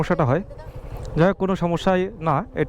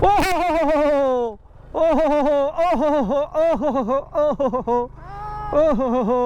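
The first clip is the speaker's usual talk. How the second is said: excited whooping; 8 semitones higher than usual. Real, not acted. Cheering while climbing steep road.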